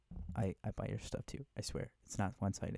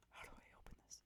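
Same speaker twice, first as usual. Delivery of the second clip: whispering; 16 dB quieter, much faster than usual. Real, not acted. ASMR whisper video.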